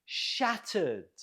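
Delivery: SHAT stressed in Shattered, second syllable falling away; stress on first syllable. Shown as a demonstration. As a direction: model Shattered with the weight up front. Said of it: "stress on the first syllable".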